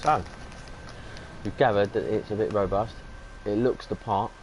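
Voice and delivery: talking while eating crisps; crunching chips. Real, not acted. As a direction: mouth full of crisps. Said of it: "sorry, I'm eating crisps".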